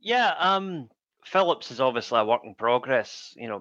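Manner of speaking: ordinary talk, nothing marked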